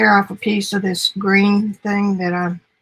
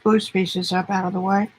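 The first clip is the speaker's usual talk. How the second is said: usual speaking voice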